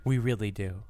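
soft, low and drowsy; sleepy low volume. Shown as a demonstration. Host complains of falling asleep, low and hushed. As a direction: drop it to a lulling, hushed level. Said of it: "We'll do it just like this".

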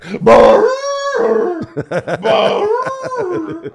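loud high imitated "brrr"; shrieked cartoonish trill, long and high; wacky, very loud whooping noise; wacky lady noise. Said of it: "the wacky lady who works there".

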